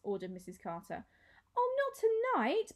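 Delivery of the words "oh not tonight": high slow plea; begging. A narrator's reading. Maya begging to keep Clovis tonight, high. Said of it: "begged Maya".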